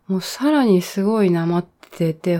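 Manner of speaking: usual speaking voice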